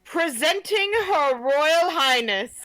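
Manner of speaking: herald announcing. Pretend. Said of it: "could you do a little bit of announcing".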